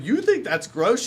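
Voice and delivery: high, loud, slow mock outrage; exaggerated retold incredulity; indignant disbelief. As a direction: stretch it out as an appalled question. Retells indignation at nurse's reaction.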